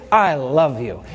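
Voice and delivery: low programmed machine voice; robot computer voice. Pretend.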